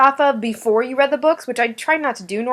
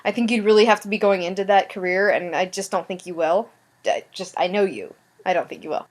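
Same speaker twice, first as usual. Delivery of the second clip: normal talking voice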